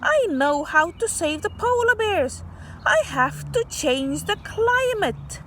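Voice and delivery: bubbling over with an idea; excited child. Acted. Child at night announcing his plan, excited.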